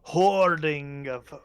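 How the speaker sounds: loud, imperious monarch; demanding goblin king. Acted. Goblin king demands the dwarves' hoarded valuables, loud and imperious. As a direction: press them grandly for the treasure.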